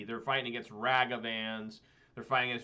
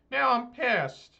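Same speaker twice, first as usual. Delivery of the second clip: high, slow, exaggerated fuming; mock anger; 11 semitones higher, 6 dB louder, slower than usual. Customer fuming at the store about the price. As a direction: drag out the hammed-up fury.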